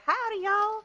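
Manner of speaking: high, loud Southern howdy; Southern accent. Retold or imitated. Guy recalls meeting Fraser, loud high Southern accent. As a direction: throw out a big Southern greeting. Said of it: "it was like".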